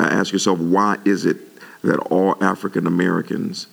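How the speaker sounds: usual speaking voice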